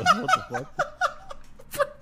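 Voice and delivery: very high, drawn-out squeal; 1 mock shock. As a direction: stretch it into a slow shocked squeal. Imagining the friend's shock at a mole.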